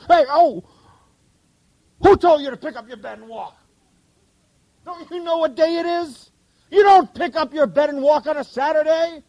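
high, nagging interrogator's voice; indignant Pharisee. Retold or imitated. Preacher voicing the Pharisee scolding the healed man, high and indignant. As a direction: play the outraged Pharisee grilling the healed man. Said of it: "Columbo the Pharisee".

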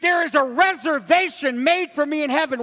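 full-voiced sermon delivery; preaching. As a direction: preach it out to the congregation.

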